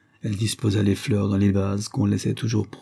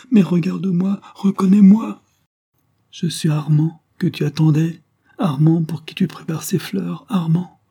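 high, impassioned pleading building louder; desperate plea; 8 semitones higher, 4 dB louder than usual. A narrator's reading. Count begs Madeleine to recognise him as Armand, desperate. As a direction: implore with mounting desperation.

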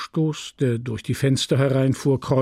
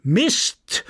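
high-pitched official proclamation; chairman calling out; 6 semitones higher than usual. Acted. Chairman announces fair prize categories to the crowd, calling out. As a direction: call out one item from the list.

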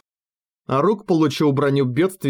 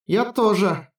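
put-on girl character voice; 6 semitones higher, slower than usual. Utai agrees in character voice.

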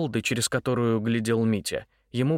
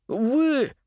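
much higher, choked exclamation; stunned; 13 semitones higher, 3 dB louder than usual. Acted. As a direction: gasp the word out in shock. Mitya sees the thread ball.

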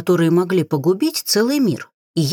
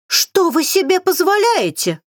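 squeaky, much higher indignant yell; squeaky shouting; 12 semitones higher than usual. A narrator's reading. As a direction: screech it in a squeaky voice. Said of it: "кричал он писклявым голосом".

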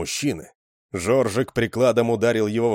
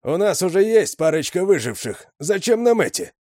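put-on higher, whining young voice; whiny complaint; 10 semitones higher, 5 dB louder than usual. A narrator's reading. Young Rzhavy whines at Sedoy, high and complaining. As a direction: whine at the boss. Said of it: "проныл Ржавый".

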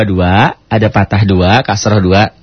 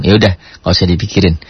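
normal talking voice

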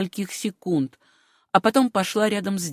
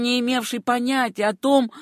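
ordinary delivery

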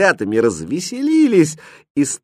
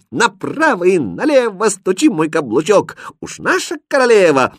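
high, bouncy chanted verse; cooks' boys continuing; 9 semitones higher, 3 dB louder than usual. A narrator's reading. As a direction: rattle the rhyme out high, like kitchen boys. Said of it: "Поварята продолжили". (звонкая припевка поварят)

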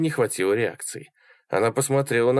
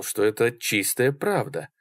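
ordinary delivery, nothing marked

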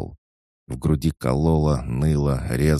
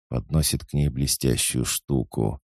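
ordinary delivery

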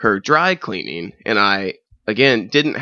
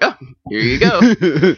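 slow eager compliance; 7 semitones higher, 5 dB louder, slower than usual. Retold or imitated. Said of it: "And I was like".

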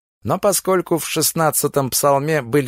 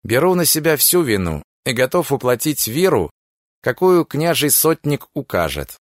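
ordinary delivery

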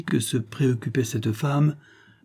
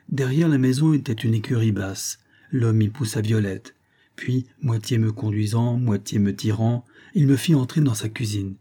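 plain reading voice

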